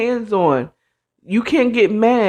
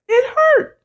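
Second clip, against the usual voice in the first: emphatic complaint; 3 dB louder than usual. Acted. Insisting on the pain.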